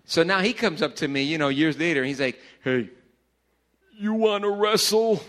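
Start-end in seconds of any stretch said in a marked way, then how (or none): 2.6-5.2 loud, high mock challenge from the little brother; big brother's challenge. Little brother daring him to wrestle, loud mock challenge. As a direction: call it out like a hulking kid brother.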